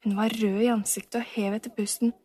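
plain reading voice

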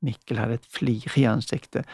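ordinary delivery

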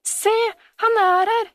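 high, loud, overjoyed exclamation; joyful shout. A narrator's reading. Camilla hugging the found Sebastian, shouting joyfully. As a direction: shout it out in delight. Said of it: "ropte hun".

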